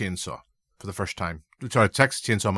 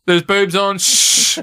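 high, loud joking shush; mock shush; 10 semitones higher, 9 dB louder, much slower than usual. Joking along about the audience, loud mock shush. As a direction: hush them for the boobs, hammed up.

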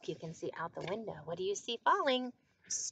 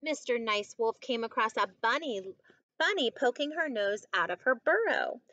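measured picture-book narration, fuller voice than the chat; story reading to children; 2 semitones higher, 9 dB louder than usual. A narrator's reading. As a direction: read it out clearly for young listeners.

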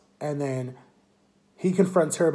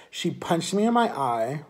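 ordinary talk, nothing marked

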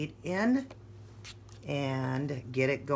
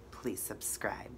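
soft unvoiced whisper; hushed sign-off to the viewers; 5 semitones lower, 13 dB quieter, slower than usual. Real, not acted. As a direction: whisper the goodbye close to the mic.

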